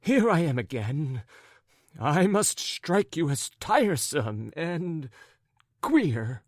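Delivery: high, animated character voice full of fuss; flustered raccoon-coated gentleman. Acted. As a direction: play a fretful, wounded gentleman.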